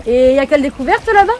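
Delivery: fast loud call. Real, not acted. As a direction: shout the question quickly. Calling out to cousin. (appel rapide et fort)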